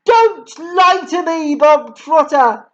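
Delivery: raging headmistress. A narrator's reading. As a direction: rant like a furious tyrant. Headmistress rants at the boy.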